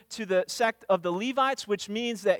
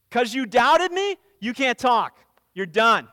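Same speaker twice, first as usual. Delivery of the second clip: stern angel rebuke; 6 dB louder than usual. Retold or imitated. Angel Gabriel punishing Zacharias for doubting, loud stern rebuke. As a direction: come down hard on him, no appeal.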